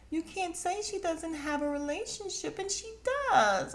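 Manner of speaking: high, squeaky put-on whine; high shrill imitation of Mia butting in; mocking high voice. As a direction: mimic someone butting in. Mia mocked chiming in.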